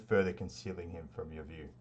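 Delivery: normal talking voice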